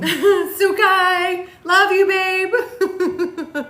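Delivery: high, drawn-out singsong; singsong call. Real, not acted. Telling babe she loves them, high playful singsong. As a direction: call out sweetly in a loud, stretched singsong. Calls out affection to partner.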